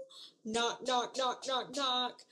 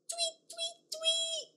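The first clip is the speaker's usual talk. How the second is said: high soft tweet tweet; bird tweets; 11 semitones higher, 5 dB quieter than usual. Acted. Teacher chirping bird calls for toddlers. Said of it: "Birds go".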